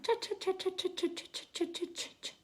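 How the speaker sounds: high chattering noise, fading to unvoiced; imitated babble of many talkers. Shown as a demonstration. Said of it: "multiple people kind of talking at the same time".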